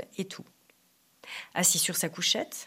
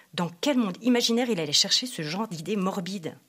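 ordinary talk, nothing marked

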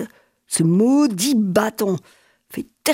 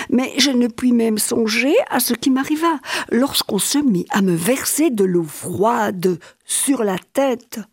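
usual speaking voice

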